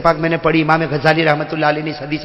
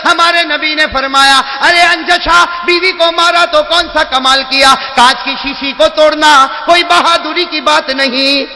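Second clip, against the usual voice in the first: raised high, loud, slow sermon delivery; loud preaching; 8 semitones higher, 4 dB louder, slower than usual. Acted. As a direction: proclaim it to the crowd. Preacher quoting the Prophet. (بلند آواز میں خطابت)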